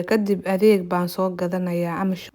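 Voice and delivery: ordinary talk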